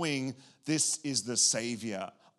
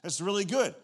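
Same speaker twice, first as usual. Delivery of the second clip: mock excitement; 8 semitones higher, 6 dB louder than usual. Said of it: "And I'm like".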